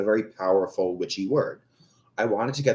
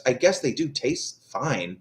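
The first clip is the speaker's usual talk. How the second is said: normal talking voice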